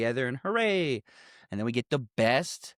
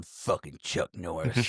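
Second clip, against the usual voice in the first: low, slow Goodman; 7 semitones lower, 5 dB quieter, slower than usual. Retold or imitated. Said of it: "ends with him going".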